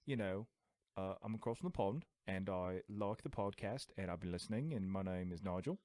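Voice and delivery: soft, low put-on British accent. Shown as a demonstration. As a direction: pose as a mild-mannered British listener. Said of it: "talking with a British accent".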